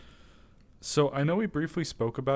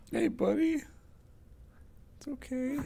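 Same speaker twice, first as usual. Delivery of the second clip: much higher, soft calling to a pet; gentle high coaxing; 12 semitones higher, 3 dB quieter than usual. Real, not acted. Calling the spooked little guy, high gentle coaxing. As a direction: lure the scared creature out.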